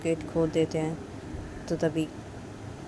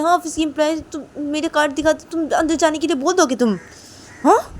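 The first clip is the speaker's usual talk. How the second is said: much higher, loud mocking rage; furious scolding; 5 semitones higher, 7 dB louder than usual. Mistress furiously lecturing guards about permission. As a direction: tear into the guards. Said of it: "तो तभी बोलती".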